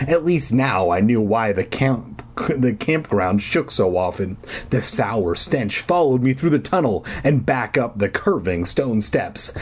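ordinary delivery

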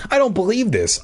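high delighted disbelief; gleeful. A narrator's reading. Bee seeing the bullies scared of it, gleeful. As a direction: lift the pitch with glee. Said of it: "I thought gleefully".

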